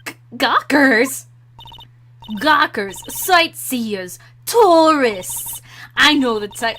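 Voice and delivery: loud, indignant mock old lady. Old security lady sizes up the tourists, loud scolding voice. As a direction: spit out each word as a badly faked old guard. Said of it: "I can't do an old lady voice".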